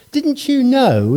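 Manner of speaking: lightly voiced disbelief. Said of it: "It's sort of a disbelief".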